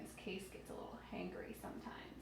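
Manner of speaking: hushed playful aside; teasing whispered aside. Real, not acted. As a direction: whisper it like a little secret. Playful aside about being hungry.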